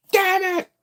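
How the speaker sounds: high loud frustrated damn it; mock outburst. Vlogger reacting to the villains showing up, high loud mock outburst.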